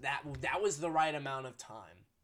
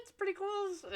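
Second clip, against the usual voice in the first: much higher, mocking squeak; squeaky high voice. Repeats phrase, then squeaky version.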